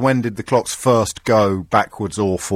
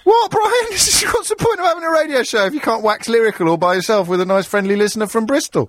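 very high-pitched, laughing outrage; mock indignation; 8 semitones higher than usual. Host defending his rambling to listener from Bristol, mock indignation. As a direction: protest theatrically, pitch shooting up.

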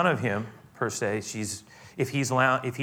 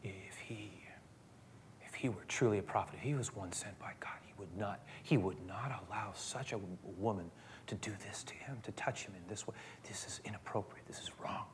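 a judging whisper under the breath; host whispering his judgment to himself; inner whisper; 3 semitones lower, 15 dB quieter than usual. Retold or imitated. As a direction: hiss it privately, disapproving. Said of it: "he says to himself".

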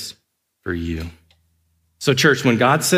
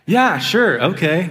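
high mock-agreeable sarcasm; sarcastic yeah sure; 7 semitones higher, 3 dB louder, slower than usual. Dad teasing daughter about pet gorilla, high sarcastic. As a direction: pretend to agree, then drop it.

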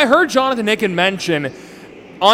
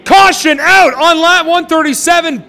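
shouted crash call, much higher; motorsport commentator; 9 semitones higher, 9 dB louder, slower than usual. Real, not acted. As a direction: yell the crash as it happens. Crash called live.

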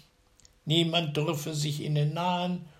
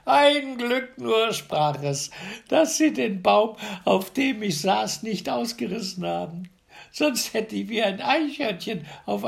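much higher, lively, cheeky relief; mock the dead giants; relieved tailor; 8 semitones higher, 5 dB louder than usual. A narrator's reading. Tailor speaks in story.

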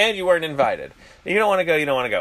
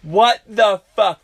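shocked; 6 semitones higher, 6 dB louder, much slower than usual. Real, not acted. Shocked at NBA owners news.

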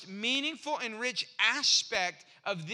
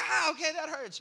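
mock pained yelp, pitched up; higher voice of a patient caught sore; pained reaction; 6 semitones higher, 3 dB louder than usual. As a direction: wince through the words, lightly. Acting patient's pain when squeezed.

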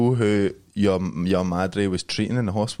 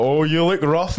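higher, louder teasing remark; mock boss jibe; 6 semitones higher, 4 dB louder, slower than usual. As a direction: needle him like a cheeky boss. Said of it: "he's like".

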